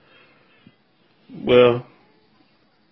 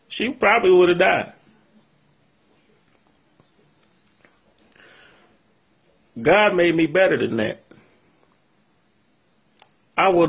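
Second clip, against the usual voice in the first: hurt; 4 semitones higher than usual. Real, not acted. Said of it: "I've been crying 'cause I'm, I'm hurt".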